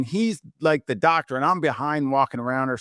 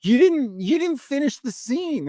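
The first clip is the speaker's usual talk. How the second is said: much higher, urgent scolding voice; exasperated manager; 14 semitones higher than usual. Retold or imitated. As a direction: act the manager in disbelief. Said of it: "She's like".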